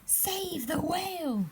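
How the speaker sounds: high ringing call to action; high urgent message; urgent plea. A narrator's reading. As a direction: cry it out as a rallying plea. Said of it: "a silvery trail saying".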